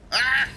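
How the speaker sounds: high loud yelp of pain; sudden squeaky death scream; death cry. Pretend. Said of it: "Lego Yoda death scream".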